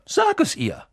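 urgent, high-pitched order. Acted. Friend urging a timid boy to speak up, urgent. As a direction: press them, voice leaping high. Hermine urges Neville.

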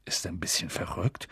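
whispered, soft unsure question. A narrator's reading. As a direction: ask it under your breath. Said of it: "fragte er Percy unsicher". (unsicheres Flüstern)

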